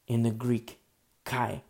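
ordinary talk

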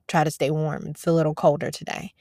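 normal talking voice

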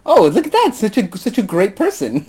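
high, laughing mock praise; mock admiration. Mocking how the film's Indians praise the British, laughing mock awe. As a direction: gush with fake wonder, half laughing.

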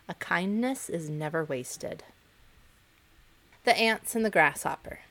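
ordinary delivery

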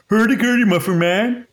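high, bouncy mock Scandinavian gibberish; a hammed-up Swedish chef voice; mock Swedish chef accent. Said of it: "Is that Danish?".

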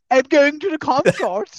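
high, slow posh drawl, put on like a snob; posh singsong. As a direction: ham up a toffee-nosed voice. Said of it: "where people perform".

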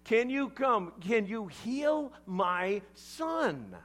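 acted, urgent begging for help. Desperate father begs Jesus to heal his son, begging. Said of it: "begs and begs".

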